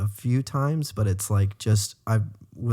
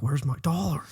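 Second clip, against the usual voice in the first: higher-pitched imitation of an impatient fan; mock demanding; 5 semitones higher, 3 dB quieter than usual. Host imitating fans waiting for payment, mock demanding. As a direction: playfully demand it, pitch lifted.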